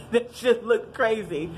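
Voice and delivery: breaking into a laugh mid-sentence; mocking the wedding video, dissolving into laughter. Real, not acted. Laughing it off.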